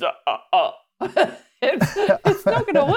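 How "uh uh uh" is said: slow vampire cackle, "uh, uh, uh"; drawn-out mock villain laugh; Dracula laugh. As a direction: chuckle slowly like a cartoon vampire. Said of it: "doing a Dracula accent".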